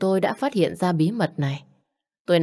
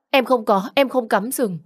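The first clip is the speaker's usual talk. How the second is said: higher, louder pleading denial; desperate denial; 6 semitones higher, 3 dB louder than usual. Acted. Wife desperately denying she cheated on her husband, high and pleading. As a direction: protest your innocence in panic.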